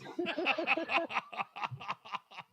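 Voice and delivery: high, helpless belly laughter; hearty laugh. Real, not acted. Host cracking up at caller's embarrassing story, hearty laugh. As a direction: crack up at length.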